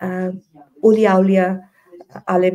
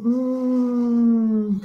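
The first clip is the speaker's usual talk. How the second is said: long steady hum imitating a far-off sound; droning "hmmm" sound effect; imitated distant drone; 4 semitones higher than usual. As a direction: hum one drawn-out, even note. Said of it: "এরকম শব্দ".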